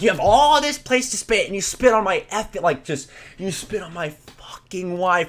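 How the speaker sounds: high-pitched, strained outraged rant; mock livid. Livid husband accusing a teen of spitting, mock fury. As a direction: imitate a husband beside himself with rage. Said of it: "so he's just livid".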